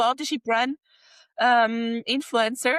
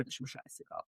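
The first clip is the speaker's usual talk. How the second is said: muttered aside; 9 semitones lower, 18 dB quieter, faster than usual. Real, not acted.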